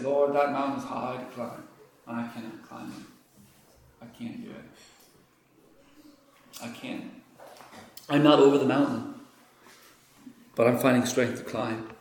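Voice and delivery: low, hushed plea, almost breaking; hushed desperate prayer. Retold or imitated. Praying alone on a walk, a hushed desperate plea. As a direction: pray it quietly, like you're at the end of yourself. Praying in despair before the mountain.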